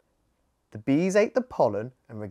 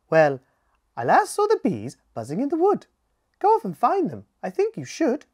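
high, quick and louder bird voice; hummingbird voice; 7 semitones higher, 7 dB louder, faster than usual. Acted. Hummingbird sending Scout off to the wood, high and quick. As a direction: rattle off directions like an eager little bird.

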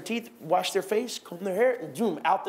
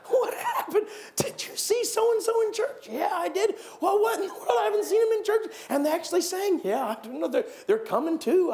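amazed churchgoers; 7 semitones higher than usual. Retold or imitated. Churchgoers marvelling at a changed neighbour, high and amazed. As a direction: play baffled busybodies, hammed up. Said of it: "gossip about a changed man".

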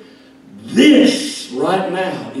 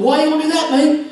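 mock Australian accent; 11 semitones higher, 4 dB louder, faster than usual. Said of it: "He came back talking like that".

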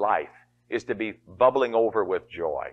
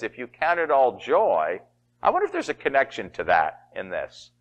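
ordinary talk

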